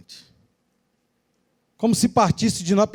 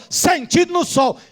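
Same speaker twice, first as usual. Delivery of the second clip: higher barked drill order; sergeant's command; 9 semitones higher than usual. Retold or imitated. Sergeant ordering recruits to stand in the sun, mock barked command. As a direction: call it like a sergeant on parade. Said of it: "o sargento falou".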